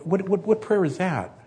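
ordinary delivery

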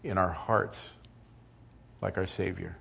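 soft, hushed prayer voice; closing prayer. Acted. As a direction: pray quietly before the congregation. Closing prayer before sermon.

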